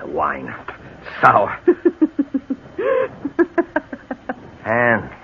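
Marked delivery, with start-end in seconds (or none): 1.6-2.5 short bitter laugh. Acted. Lone laugh over sour wine.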